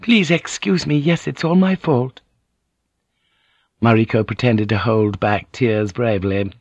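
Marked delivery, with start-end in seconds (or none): 0.0-2.2 very high, apologetic lady; submissive, wide-eyed apology; meek, flattering noblewoman. A narrator's reading. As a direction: fawn in a high feminine voice.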